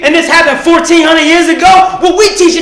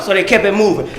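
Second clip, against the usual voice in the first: normal talking voice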